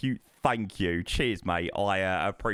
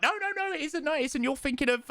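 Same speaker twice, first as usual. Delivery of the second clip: mock know-it-all; 11 semitones higher, faster than usual. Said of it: "somebody was like".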